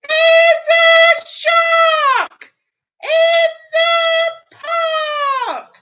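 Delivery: high, loud, slow drawn-out yell; yells with all his might. Acted. Timothy warning everyone in the park, a big drawn-out yell. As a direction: shout it out with all your might. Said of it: "Timothy yells with all his might".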